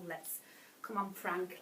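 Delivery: ordinary delivery, nothing marked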